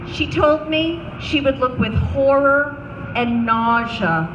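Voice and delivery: ordinary delivery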